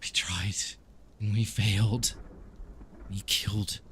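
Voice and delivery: loud, anguished confession breaking into fragments; tormented vampire character. Acted. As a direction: confess the failure in halting bursts. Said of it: "he says".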